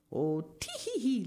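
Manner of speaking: high tittering "tee hee hee"; little woman's light, high-pitched laugh; giggling. A narrator's reading. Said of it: "laughed the little woman".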